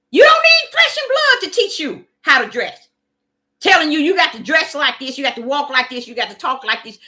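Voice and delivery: fervent preacher's outburst; preaching. Acted. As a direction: fire the questions at them. Preaching burst, loud high.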